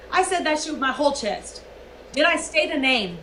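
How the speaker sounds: ordinary talk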